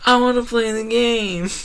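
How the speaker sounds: high mock-whiny voice; mocking a crowd of eager players. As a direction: whine it like an impatient newbie.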